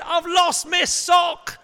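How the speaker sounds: put-on loud, slow wailing shout of a child; child's shout. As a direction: wail it up the stairs like a kid who lost a sock. Child calling from upstairs.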